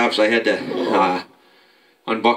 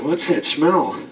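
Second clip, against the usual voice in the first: higher sister voice, suspicious; 5 semitones higher, much slower than usual. Retold or imitated. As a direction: ask it like a nosy sister. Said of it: "my sister said".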